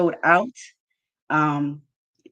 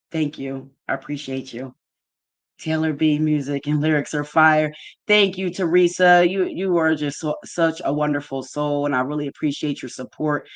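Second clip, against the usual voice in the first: ordinary talk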